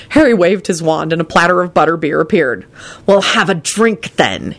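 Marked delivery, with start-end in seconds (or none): 3.1-4.5 snapping. A narrator's reading. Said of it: "he snapped at Kenny".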